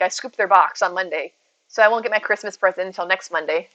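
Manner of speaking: ordinary delivery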